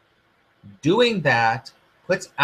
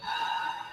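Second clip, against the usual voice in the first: long unvoiced breath out; deep exhale; 14 dB quieter than usual. Shown as a demonstration. Coach leading viewers through a deep breath out. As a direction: let a slow breath go audibly. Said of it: "Take a deep breath in this moment with me".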